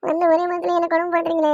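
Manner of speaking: high, whiny fake-tearful complaint; mock crying complaint. New bride whining to her mother-in-law, mock crying. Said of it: "எதுக்கும்மா அழுகுற?".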